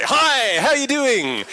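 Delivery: high, loud, overeager hello; overexcited greeting. Retold or imitated. Barging into a two-person meeting, mock overeager hello. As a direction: burst in way too keen. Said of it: "They're going to think you're a bit nuts".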